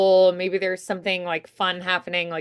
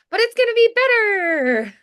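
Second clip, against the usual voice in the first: much higher and louder, bright hopeful lift; sudden upbeat turn; 13 semitones higher, 6 dB louder than usual. Shown as a demonstration. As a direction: flip to cheerful optimism. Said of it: "the sharp contrast".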